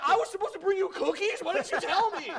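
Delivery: squeaky, laughing bewilderment; high and giggly, playing dumb; mock confused high voice. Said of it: "I'm like".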